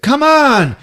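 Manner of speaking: high, loud urging shout; impatient mock yell. [name] urges the kid who won't wake, impatient shout.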